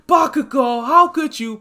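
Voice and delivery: hammed-up class scolding Bakugo; outraged shout. Whole class yells at Bakugo.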